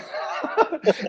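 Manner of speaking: short breathy laugh; breathy chuckle at the punchline; real laugh. Real, not acted. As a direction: laugh at the memory.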